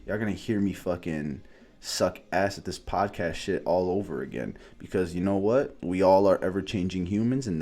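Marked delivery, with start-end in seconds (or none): none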